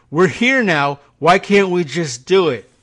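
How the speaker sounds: mock-impatient why can't we; impatient Trump. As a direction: push like a bored boss.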